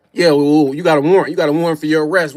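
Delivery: lightly voiced deep-toned officer; cop voice. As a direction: play the cop reading out the warrant. Voicing the cop in story.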